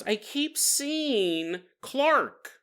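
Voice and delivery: high, very slow, drawn-out words; mock haunted confession. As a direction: stretch it out as an imitated frightened patient. Nancy confesses, then teller repeats it.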